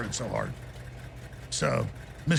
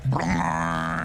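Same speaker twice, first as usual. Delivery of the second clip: mock fart noise. Imitating a fart about Kerry.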